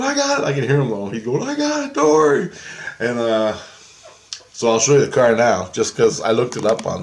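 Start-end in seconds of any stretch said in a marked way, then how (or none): 0.0-0.4 high, strained voice; Jesus straining. Retold or imitated. Jesus hauling the car through the dreamer, high strained. 1.5-2.5 high, put-on cheerful voice; Jesus reassuring. Jesus reassuring the dreamer, high and eager.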